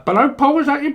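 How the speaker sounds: high, loud squeaky phone voice; mock caller. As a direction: squawk the greeting down the line.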